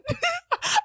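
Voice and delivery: very high, loud mock wail; comic sobbing cry; imitated bawling. Graduate acting out bawling on stage, high mock wail. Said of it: "I was bawling".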